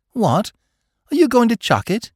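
high-pitched, startled disbelief; astonished question. A narrator's reading. Mike hears his friend is leaving the bank, astonished. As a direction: ask it in surprise, pitch leaping up.

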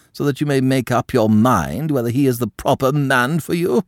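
high, loud, disdainful aristocrat; a scandalised French countess lecturing her niece; haughty old countess. A narrator's reading. Madame condemns Quentin.

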